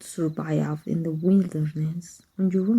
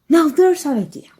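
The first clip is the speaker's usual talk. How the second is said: mock delight; 9 semitones higher, 7 dB louder than usual. Mentor mocks a suggestion.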